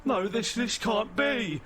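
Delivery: high-pitched, disbelieving dread; high, slowing cry of disbelief; horrified robot officer. Acted. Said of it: "in sheer terror".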